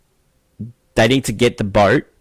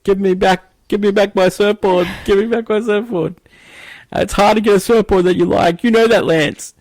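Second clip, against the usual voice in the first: high, loud mock whine; hammed-up plea for the surfboard; Robert Duvall impression; 10 semitones higher, 4 dB louder than usual. Kilgore yelling over the helicopter for his surfboard, high mock whine. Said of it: "over the helicopter saying".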